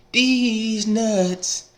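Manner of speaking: high, squeaky comic voice on these nuts; squeaky punchline. Acted. Mocking his league rival with a high squeaky these nuts. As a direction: pop it up high and silly like a punchline.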